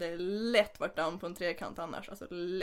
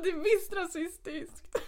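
higher, slow mock-indignant insistence; upset insisting; 8 semitones higher, slower than usual. Girl insisting to the Italian guy, mock-indignant and slow. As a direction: protest it, drawn out and higher. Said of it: "Jag blev så himla upprörd".

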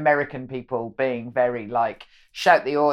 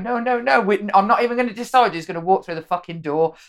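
high, louder, fed-up outburst; exasperated Brit; 8 semitones higher, 5 dB louder than usual. Retold or imitated. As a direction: lose patience at the door standoff. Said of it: "very stereotypically British, isn't it, to be like".

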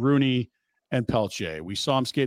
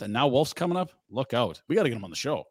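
usual speaking voice

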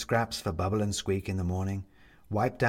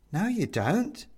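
a hint of a dry, higher retort; lightly voiced Mum; 9 semitones higher, slower than usual. Mum correcting Bobs, lightly dry. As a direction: cut him down mildly.

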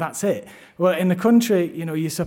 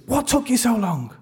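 higher, whiny reproach; reproachful wife; 7 semitones higher, 4 dB louder than usual. Retold or imitated. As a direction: complain like she's been left waiting for ages. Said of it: "the first thing she says to me is".

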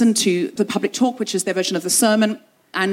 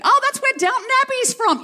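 very high, sing-song church lady voice; nosy older sister. Retold or imitated. Older sister quizzing a visitor at the door, high and sweet. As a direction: chirp it out like a sweet busybody.

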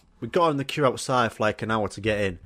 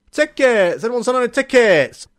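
loud, high street-hawker call; ticket tout shout; 10 semitones higher, 7 dB louder than usual. Retold or imitated. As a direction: bellow it down the queue. Touts calling outside venue.

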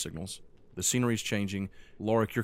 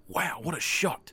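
impressed; 8 semitones higher, slower than usual. Acted. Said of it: "Thorn's like".

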